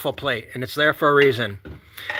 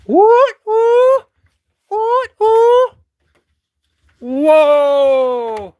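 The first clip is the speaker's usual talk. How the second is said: high, loud whoops of joy; whooping; 6 dB louder, much slower than usual. Real, not acted. Vlogger whooping at the snowy yard, high and loud. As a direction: let out excited hoots. Hooting at the snow.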